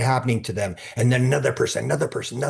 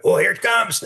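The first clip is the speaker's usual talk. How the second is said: high, thrilled, half laughing; delighted; 10 semitones higher, 4 dB louder than usual. Retold or imitated. Feeling gratitude arrive in a psychedelic session, high and thrilled.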